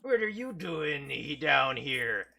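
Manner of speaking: loud gruff pirate greeting; pirate captain voice. Acted.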